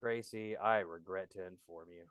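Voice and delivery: very low, hushed regretful announcement; mock-solemn. Host telling Gracie he has to go, mock-solemn and hushed. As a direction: break it gravely, barely voiced. Stages a grave exit before guest.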